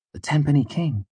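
high, scoffing disbelief; incredulous. A narrator's reading. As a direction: cut in, unable to believe it. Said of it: "Denner interrupted incredulously".

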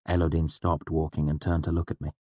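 usual speaking voice

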